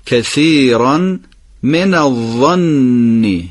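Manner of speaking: very slow, من الظن with a doubled نون; slow recitation. Shown as a demonstration. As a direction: read من الظن slowly, dwelling on the شدّه. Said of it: "چرا این عبارت رو دقت باید بفرمایید". (آهسته با تشدید روی ظن)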